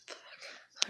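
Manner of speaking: hushed frustrated swear; whispered curse. Real, not acted. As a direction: swear under your breath.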